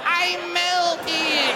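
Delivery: slow, high, hammed-up witch wail; slow mock melting whine. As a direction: drag it out like the Wicked Witch dying. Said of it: "and you go".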